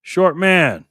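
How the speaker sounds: hype call. Real, not acted. Streamer hyping a viewer's spot on the map, excited call. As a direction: shout the nickname out like an emcee.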